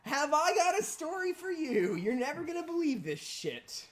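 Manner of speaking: high, eager, loud storyteller's hook; excited. Retold or imitated. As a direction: sell it like a juicy tale. Retelling own excited reply.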